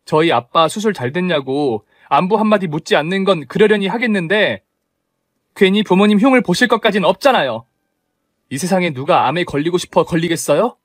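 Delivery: daughter-in-law defending her sick father to her mother-in-law; confronting. Acted. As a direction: challenge her point by point.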